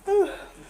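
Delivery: loud, high intake of breath; gasp for air. Real, not acted. After telling mom everything, loud gasp for breath. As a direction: gasp after running through a long list.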